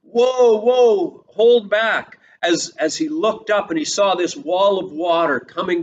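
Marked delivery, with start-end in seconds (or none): 0.0-2.2 high, loud frightened shouting; panicked yell. Retold or imitated. Sisera sees the wall of water coming: high, loud panicked yell. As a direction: try to rein in the horses in terror. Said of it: "You can hear Sisera yelling".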